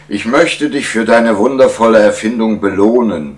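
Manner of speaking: a king grandly offering a reward; gracious king. Acted. King rewarding the inventor, grand and loud.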